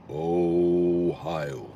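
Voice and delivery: low, long-held Ohio; drawn-out low echo. Real, not acted. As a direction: stretch it out deep and level. Same word again, dropped low.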